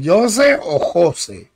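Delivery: slow "Jose" with a hard English-like J, then "José" with a Spanish h; two pronunciations compared. Shown as a demonstration. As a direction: set "Jose" against "José", drawn out. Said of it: "Te lo voy a pronunciar".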